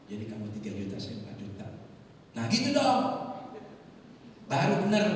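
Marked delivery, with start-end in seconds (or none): 2.4-5.1 high, booming satisfaction; loud approval. Acted. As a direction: exclaim it as if finally satisfied. Other man's loud approval, retold. (seruan puas yang lantang)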